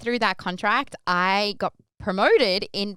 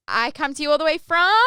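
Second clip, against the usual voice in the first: higher, louder prompt to the crowd; crowd call-out setup; 7 semitones higher, 6 dB louder than usual. Retold or imitated. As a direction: lead the audience into the punchline. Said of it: "I would be able to have everybody say".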